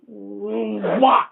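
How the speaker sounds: loud, high whoosh of takeoff; imitated whoosh. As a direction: mimic something suddenly shooting away, loudly. Imitating the light suddenly shooting off.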